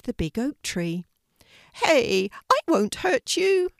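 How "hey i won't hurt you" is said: high, loud little rabbit voice; gentle rabbit calling out to a scared puppy; bright high rabbit, loud. A narrator's reading.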